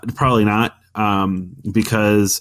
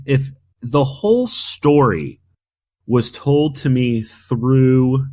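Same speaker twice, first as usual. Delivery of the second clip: ordinary talk, nothing marked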